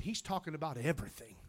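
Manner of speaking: normal talking voice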